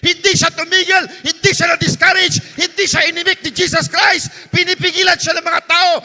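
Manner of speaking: loud, fervent, sing-song preaching; preaching at full force. Acted. Pastor urging the church to keep praying, fervent shouted preaching. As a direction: drive it home to the congregation.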